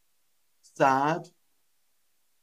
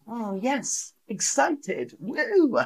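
high-pitched whooping excitement; excited; 9 semitones higher than usual. Shown as a demonstration. Teacher acting out excited for learners, excited whoop. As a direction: build to a gleeful high woo.

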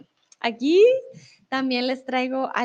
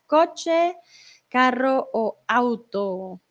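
slow listing; much slower than usual. Shown as a demonstration. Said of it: "teacher repeats options".

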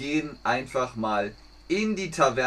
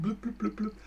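bubbling sound effect; 8 semitones higher, 8 dB quieter, faster than usual. Retold or imitated. Sinking ship sound.

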